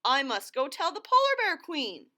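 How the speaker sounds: a fox changing its story fast; high flustered fox; rattled fox. Acted. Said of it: "howled Foxy Loxy".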